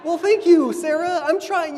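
put-on dad answering his toddler, laughing sarcastically; sarcastic laugh. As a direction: laugh it off with mock thanks. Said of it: "laughing sarcastic reply".

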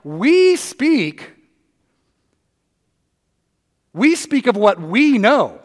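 "We" punched and drawn out, slowly; stress on we. Retold or imitated. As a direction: answer back, leaning on "We". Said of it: "And Jesus is like".

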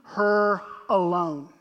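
slow, deliberate commanding words; firm command. Acted. Jesus firmly defending Mary, slow commanding. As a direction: say it slowly like Jesus protecting her. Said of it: "Jesus says".

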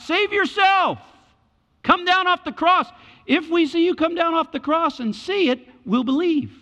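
loud taunt, very high pitch; mocking crying out. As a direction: heckle the condemned man at a high shout. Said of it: "And they're crying out".

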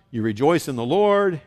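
usual speaking voice

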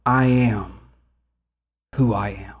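low, soft voice of God; regal God voice. Acted. God answering Moses's question, low and regal. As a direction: answer with hushed, awesome authority. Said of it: "this awesomeness with how God answers".

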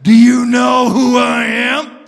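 loud menacing devil's roar. Acted. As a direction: bellow like the devil. Satan voiced in a joke.

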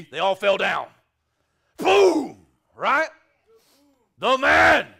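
big, loud preaching burst; triumphant shout. Real, not acted. Preacher shouting to the church about soldiers falling, full force. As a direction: shout it out as the climax lands.

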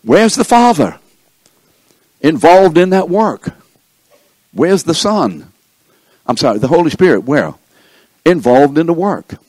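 high, loud rhetorical questions from the pulpit; forceful preaching. Real, not acted.

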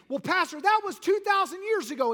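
preacher acting a listener's objection to the pastor; objecting churchgoer. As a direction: toss the objection back at the pastor.

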